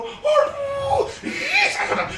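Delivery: high, laughing mock sob of a mourner; mock wailing. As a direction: ham up the crying. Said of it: "Nangis sih, seperti sampai geruk-geruk". (menirukan tangisan meraung sambil tertawa)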